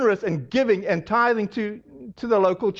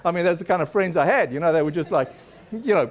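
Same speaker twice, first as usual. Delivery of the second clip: ordinary talk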